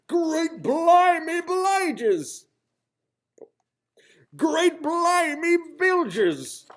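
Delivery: loud, high, slow pirate exclamations; dramatic exclamations. A narrator's reading. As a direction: stretch each word like a howling gale. Dramatic storm narration.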